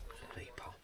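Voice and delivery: soft whisper to the fish. Real, not acted. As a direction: hush it gently. Soothing the caught fish.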